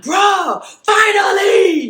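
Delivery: loud, high, stretched cry of finally; high drawn-out shout, half crying; relieved shout. Retold or imitated. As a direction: yell it like the long wait is over. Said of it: "they were like".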